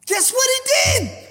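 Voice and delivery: high, impassioned preacher's question; high-pitched, fervent challenge; emphatic repeat. Real, not acted. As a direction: throw the question out high and urgent.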